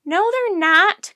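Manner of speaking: high, loud, drawn-out protest; slow high-pitched objection; crying out. A narrator's reading. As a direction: cry out in denial. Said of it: "cried Annie".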